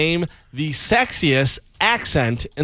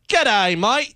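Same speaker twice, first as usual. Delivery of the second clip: put-on Australian accent; 6 semitones higher than usual.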